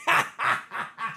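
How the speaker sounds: brief real laugh at your own pun; short cackle. Real, not acted. Streamer repeats his nickname joke, cackling.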